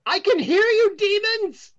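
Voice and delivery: a wary seer shouting at intruders; suspicious fortune teller. Acted. GM voices fortune teller accusing.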